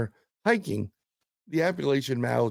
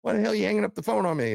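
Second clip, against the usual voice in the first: much higher and fast, scolding; mad psychic; 9 semitones higher, much faster than usual. Retold or imitated. Psychic scolding the guys for hanging up, angry. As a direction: rattle it off, furious at being hung up on. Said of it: "She's mad at us".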